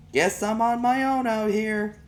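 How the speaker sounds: high, slow, loud mock complaint to nobody; a put-on loud announcement meant to be overheard; talking loud to myself. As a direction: call it out slow and high so the neighbor hears. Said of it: "talking loud to myself".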